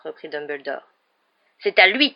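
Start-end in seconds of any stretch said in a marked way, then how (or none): 1.6-2.1 loud accusing outburst at normal pitch; raised-voice accusation; yelled accusation. A narrator's reading. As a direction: throw the blame out loudly. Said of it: "hurla Rusard".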